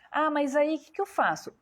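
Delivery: imagined patient. Retold or imitated. Voices a worried patient's question.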